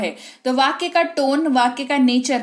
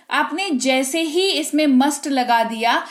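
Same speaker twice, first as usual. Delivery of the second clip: ordinary talk, nothing marked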